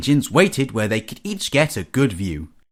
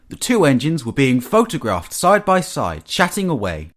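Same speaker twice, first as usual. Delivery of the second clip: ordinary delivery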